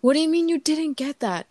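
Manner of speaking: imitating his irritated disbelief; frustrated husband. Husband snapping at his wife over her drawing, mock exasperated. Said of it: "kept getting so frustrated with his".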